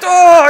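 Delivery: loud, high muffled scream; muffled yell. Retold or imitated. Patient screaming under an oxygen mask, muffled yell. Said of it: "yelling and screaming".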